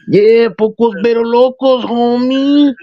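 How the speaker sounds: put-on slow, high, drawn-out Chicano accent.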